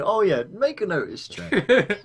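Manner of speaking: playfully exaggerated nonchalant delivery; mock nonchalance. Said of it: "Solomon nonchalantly just saying".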